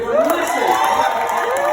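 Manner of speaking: high, slow rousing shout; rally the room for a big cheer; calling out to crowd. Real, not acted. Rallying wedding crowd for applause.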